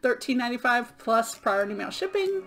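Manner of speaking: usual speaking voice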